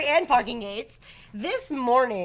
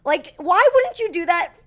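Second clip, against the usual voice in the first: exasperated; 5 semitones higher, 4 dB louder than usual. Retold or imitated. Exasperated at the attendant.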